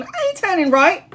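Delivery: high, defiant refusal; stubborn defiance. Retold or imitated. She defies her guides about the turn. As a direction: dig your heels in. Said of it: "I'm like".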